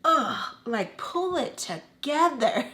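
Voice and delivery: high, slow scornful groan; annoyed dismissal. Retold or imitated. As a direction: brush off her crying. Dismissing her crying mother.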